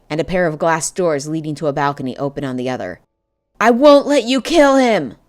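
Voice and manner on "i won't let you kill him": high, loud shout at the witch. A narrator's reading. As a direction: shout her down in desperation. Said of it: "I shouted at the witch".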